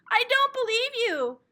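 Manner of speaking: a stubborn little sister's protest; indignant denial. Acted. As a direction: refuse to believe it, loudly. Henrietta denies from under pillow.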